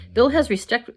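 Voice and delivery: ordinary delivery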